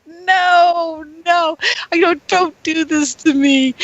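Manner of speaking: pleading. Retold or imitated. Pleading with body vibrating again.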